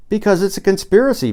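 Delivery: crying out. Acted. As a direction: cry it out like a revelation. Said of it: "Paul cried".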